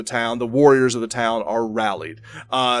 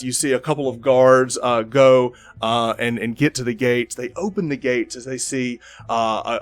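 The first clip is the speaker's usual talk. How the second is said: ordinary talk, nothing marked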